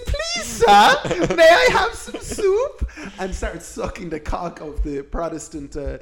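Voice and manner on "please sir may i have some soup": very high, slow, squeaky pleading with a laugh; hammed-up falsetto plea for soup; Oliver Twist beggar. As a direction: squeak it like a starving orphan. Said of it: "They said".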